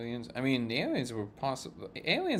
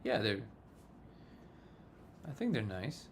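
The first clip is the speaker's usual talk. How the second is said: ordinary delivery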